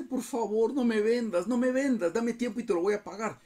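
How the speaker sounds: high, pleading cry for mercy; begging servant. Acted. Kneeling servant begging the king not to sell him, high pleading. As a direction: plead desperately, pitch up high. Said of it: "se le postra y le dice".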